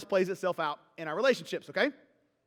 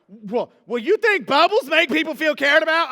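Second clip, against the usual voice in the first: louder, higher mock-sarcastic question; sarcastic challenge; 5 semitones higher, 8 dB louder than usual. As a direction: needle her with sarcasm. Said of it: "Instead of being all like".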